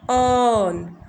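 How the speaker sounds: अर्न with a long stretched vowel; drawn-out er. Shown as a demonstration. Teacher showing the long vowel of burn, stretched. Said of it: "थोड़ा long करके बोला हमने".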